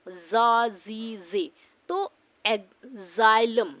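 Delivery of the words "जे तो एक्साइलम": एक्साइलम said slowly and louder; slow model word. Shown as a demonstration. Teacher slowly modelling एक्साइलम, louder and stretched. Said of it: "इसका pronunciation होता है जिया से".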